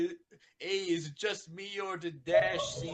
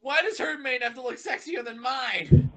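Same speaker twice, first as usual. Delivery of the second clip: jealous outburst; 6 semitones higher, 6 dB louder than usual. Acted. Rainbow jealous of Pinkie's mane.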